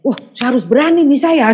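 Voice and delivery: mock-confident pep talk to oneself; retold bold self-confidence. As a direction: psych yourself up, pitched high, retelling it. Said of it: "pede sekali saya".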